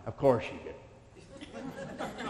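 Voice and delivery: slow, laughing sarcasm under the breath; scoffing, amused aside; sarcastic chuckle. Real, not acted. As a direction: drawl it out through a laugh. Mocking company's reply.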